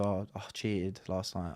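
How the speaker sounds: low, soft, slow pretend confession; role-play confession. Host role-playing cheating friend confessing to co-host, subdued. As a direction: act out a sheepish owning-up, hushed. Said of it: "let's role play".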